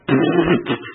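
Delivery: imitated derisive laugh; mock scoffing laugh. Greeks hearing Paul preach, mock derisive laughter. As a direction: laugh it off like a scornful crowd.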